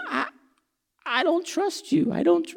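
put-on high, small child's voice; scared child. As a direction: play a frightened little child refusing. Child voiced refusing to jump.

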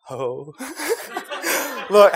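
usual speaking voice

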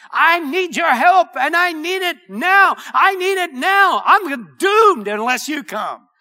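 loud, high plea in intense desperation; desperate cry for help. Acted. Preacher acting a believer crying out to God, desperate and urgent. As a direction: cry out urgently, passionately, wholeheartedly. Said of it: "reach out in intense desperation".

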